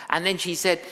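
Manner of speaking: normal talking voice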